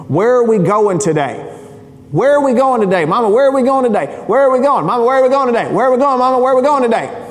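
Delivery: put-on high, pleading little boy voice; whiny kid Luke. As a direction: badger mama like an impatient child. Preacher voices his whining son Luke.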